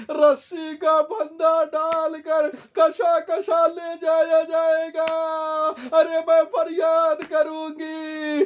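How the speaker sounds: high pleading wail, slowing on the final cries; wailing majlis lament. Acted. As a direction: cry it out to the congregation at full force. Full-force wailing lament recitation.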